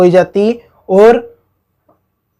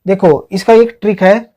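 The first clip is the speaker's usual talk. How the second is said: ordinary delivery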